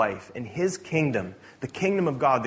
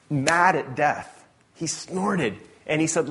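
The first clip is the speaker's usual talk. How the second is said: ordinary talk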